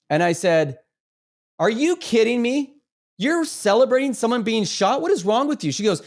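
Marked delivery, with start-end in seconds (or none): 1.6-5.7 high-pitched, incredulous confrontation; retold indignation. Gym-goer confronting a woman celebrating a shooting, high-pitched indignation. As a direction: challenge them in disbelief, voice pitched well up.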